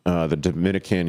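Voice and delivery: normal talking voice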